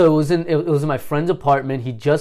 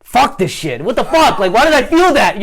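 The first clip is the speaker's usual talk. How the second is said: higher and louder, building irritation; getting mad; 9 semitones higher, 5 dB louder than usual. Retold or imitated. Retelling his acid panic turning to anger, higher and louder. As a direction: snap at yourself in mock frustration. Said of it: "I started getting mad".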